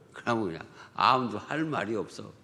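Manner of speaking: ordinary talk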